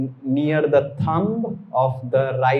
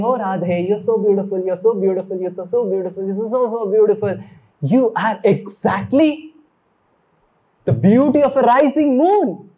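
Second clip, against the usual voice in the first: lightly voiced Krishna, rising higher and louder toward the end; Krishna admiring; 6 semitones higher, 5 dB louder than usual. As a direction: praise her beauty with growing delight. Krishna praising Radharani in story.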